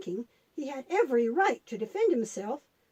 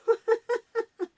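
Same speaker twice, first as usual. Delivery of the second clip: giggle; 9 semitones higher, 3 dB louder than usual. Acted. Puppet laughs at a potty joke.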